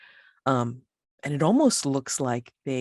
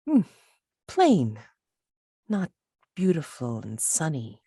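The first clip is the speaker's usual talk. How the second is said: haughty Olympian. Acted. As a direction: draw words out, puzzled by a plain place. Game master plays an Olympian visitor.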